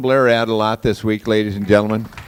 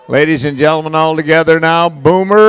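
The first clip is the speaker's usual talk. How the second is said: loud, higher hype call to the crowd; rally the room to chant the name; crowd-leading shout; 5 semitones higher, 9 dB louder than usual. Real, not acted.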